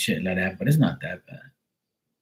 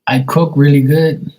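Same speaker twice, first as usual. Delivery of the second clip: "cook" said much higher and louder, rising; loud correction; 6 semitones higher, 9 dB louder than usual. Shown as a demonstration. Correcting a misheard line after a spell check, loudly. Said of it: "It's a spell check".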